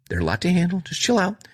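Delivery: high-pitched mock boss. Boss voiced in retold scene.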